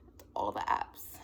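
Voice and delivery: low, slow whisper; hushed, voiceless disbelief; whispered repeat. Acted. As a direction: whisper it slowly, almost to yourself. Same words repeated in dismay.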